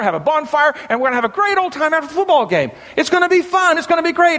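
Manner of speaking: high, fast, gushing mock invitation; eager party friend. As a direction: ham up the pushy buddy selling the beer party. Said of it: "one individual came up to me and said".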